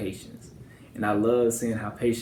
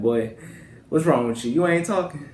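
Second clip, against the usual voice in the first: teasing grandmother; 4 semitones higher, 3 dB louder than usual. Retold or imitated. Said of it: "Like, she'll talk about, like".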